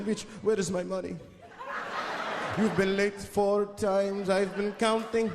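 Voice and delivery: put-on slow vampire-pimp accent climbing into high cackles; the Count as a pimp. The Count scolding his worker, slow then high counting laughs. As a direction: count the smacks like a gloating vampire. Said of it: "They called him the Count".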